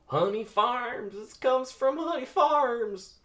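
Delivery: very high, loud, drawn-out squeal; panicked confession. Acted. Hector blurting the source in high panic. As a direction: shriek it out in terror. Hector cracks under threat.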